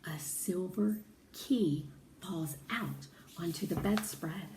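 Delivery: ordinary delivery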